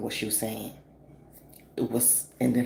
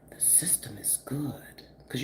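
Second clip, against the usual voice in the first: whispered aside, a bit fast; hushed confiding whisper; conspiratorial whisper; 9 dB quieter than usual. Real, not acted. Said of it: "conspiratorial aside".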